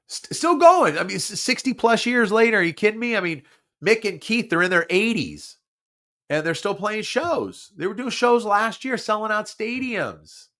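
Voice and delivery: high, amazed and loud; excited. Real, not acted. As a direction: rave about it in disbelief. Raving about the Stones.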